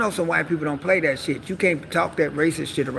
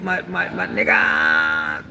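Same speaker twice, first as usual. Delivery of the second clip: much lower, slow exaggerated voice; mock deep slang; 12 semitones lower, much slower than usual. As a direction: drop way down trying to sound hard. Try-hard slang.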